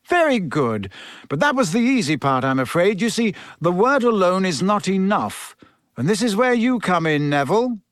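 Professor Lupin. Acted. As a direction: coach a pupil with lifted, lively intonation.

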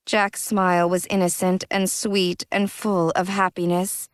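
usual speaking voice

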